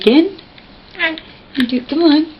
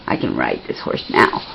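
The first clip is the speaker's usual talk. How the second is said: put-on much lower, soft made-up kid thought; voicing the boy; 7 dB quieter than usual. Mother voices her boy's thought about the horse, low and soft. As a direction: speak his thought for him.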